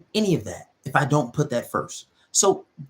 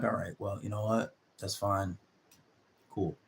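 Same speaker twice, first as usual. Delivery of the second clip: put-on calm boyfriend reply; 6 semitones lower, 9 dB quieter than usual. Guy answering his girlfriend's breakup, unbothered and quiet. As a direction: lower and much softer, unbothered. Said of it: "because you're calm".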